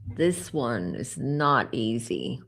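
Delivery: slow, low and soft, choking up; emotional. Real, not acted. Psychic viewer, moved by the children's deaths, speaking softly. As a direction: barely get the words out, moved. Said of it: "I'm already getting emotional".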